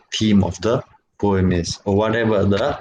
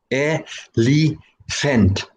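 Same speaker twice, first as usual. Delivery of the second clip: Elephant. said slowly, three separate pulses; syllables of Elephant. spelt out slowly; 4 semitones higher, much slower than usual. Shown as a demonstration. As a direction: tap out each syllable of Elephant. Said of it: "so three syllables".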